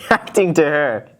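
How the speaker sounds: giggling through the words; laughing at the absurd loop. Real, not acted. Can't keep a straight face.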